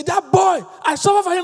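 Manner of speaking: high, loud plaintive friend; voicing a hurting friend. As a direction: play the wounded friend venting. Pastor voices a hurting friend.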